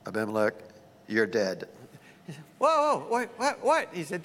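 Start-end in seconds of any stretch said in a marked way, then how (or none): 0.1-1.7 much lower, grave; God pronouncing doom in a low voice; God's warning. Acted. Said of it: "God said to him". 2.6-3.9 startled. Acted. Abimelech shocked.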